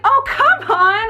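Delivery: high, slow, exasperated cajoling; drawn-out high wheedle; playful coaxing. Retold or imitated. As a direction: coax him out of his sulk. Retold coaxing of a sullen client.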